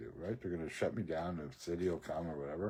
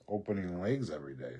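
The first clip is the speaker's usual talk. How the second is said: ordinary talk, nothing marked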